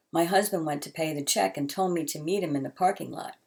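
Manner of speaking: usual speaking voice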